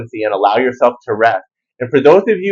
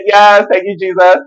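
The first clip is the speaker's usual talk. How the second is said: higher and louder, beaming gratitude; joyful thanks; 7 semitones higher, 5 dB louder than usual. Retold or imitated. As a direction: gush thanks like a happy girl. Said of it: "Look at homegirl cheesing".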